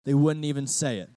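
usual speaking voice

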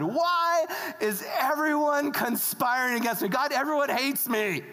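high, complaining plea; hammed-up distressed appeal; calling out to God. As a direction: cry out to God as the hard-done-by king. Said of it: "he's calling out to God".

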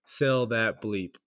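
slow, deliberate "Sell that bleep" with "bleep" said aloud as a word; spoken bleep. Shown as a demonstration. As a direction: spell out "Sell that bleep" slowly, saying "bleep" in place of the swear. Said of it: "we'll just say bleep".

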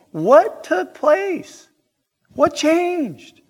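rising preaching climax. Real, not acted. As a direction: build the questions to a peak. Preacher's climactic rhetorical questions.

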